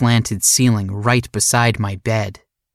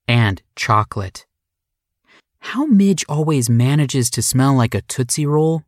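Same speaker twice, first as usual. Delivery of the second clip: usual speaking voice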